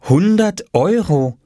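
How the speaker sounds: slow, high-pitched amazement; stretched, marvelling reading; astonished. A narrator's reading. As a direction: read it out in wonder. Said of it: "und staunte".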